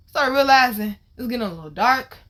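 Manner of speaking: normal talking voice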